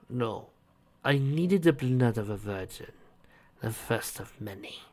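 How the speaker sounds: soft, slow and menacing; sinister man voice. Acted. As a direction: speak of the ritual coldly. Captor voice.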